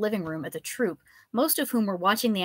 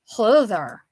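put-on a lightly slurred drunk greeting, a touch louder; drunken slur; 8 dB louder than usual. A narrator's reading. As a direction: slur it like a tipsy professor. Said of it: "he slurred".

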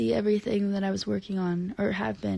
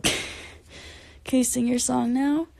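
usual speaking voice

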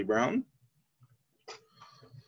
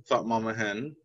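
ordinary delivery

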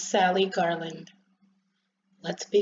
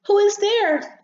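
high, loud, exasperated call; upset demand; 11 semitones higher, 7 dB louder, slower than usual. Acted. Rosebud calls to the noisy visitors, upset.

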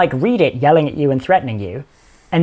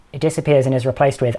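ordinary talk

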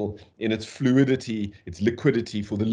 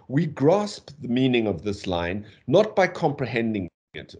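normal talking voice

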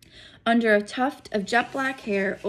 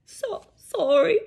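loud, high, slow sobbed apology; sobbing; 10 semitones higher, 7 dB louder, slower than usual. Acted. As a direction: sob it out. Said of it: "sobbed Hagrid".

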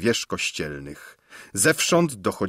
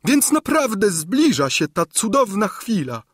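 put-on high, loud simpering old woman; gushing exclamation; 12 semitones higher, 3 dB louder than usual. A narrator's reading. Old governess gushes at the king, high and loud. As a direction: cry out like a lovesick old hag. Gburja Furia continues.

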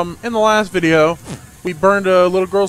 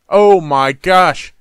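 drawn-out surprise; 8 dB louder, much slower than usual. Real, not acted. Reacting to clothes change.

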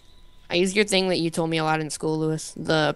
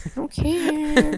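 drawn-out dismissive; 10 semitones higher, much slower than usual. Pretend.

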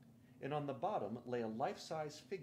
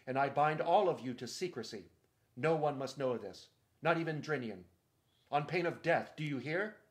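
loud, imperious royal decree; commanding proclamation; 8 dB louder than usual. Acted. Caspian claiming the island before his companions, loud decree. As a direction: proclaim it like a king binding subjects.

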